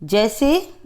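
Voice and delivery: ordinary talk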